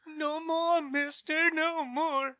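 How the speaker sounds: high, pleading whimper of a kneeling thug; begging thug. Acted. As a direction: beg for mercy in a squeaky voice.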